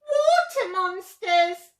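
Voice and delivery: high, loud, slow wail; frightened cry. A narrator's reading. T-Rex scared by the reflection, high loud cry. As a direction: cry out in alarm. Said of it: "and cried".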